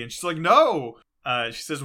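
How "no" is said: loud, higher mock refusal; emphatic refusal. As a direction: refuse loudly, acting it out. Acted refusal in retold quarrel.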